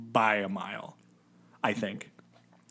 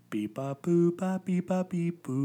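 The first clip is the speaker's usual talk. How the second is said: robot voice; 7 semitones higher, slower than usual. Pretend. Copies robot beeps.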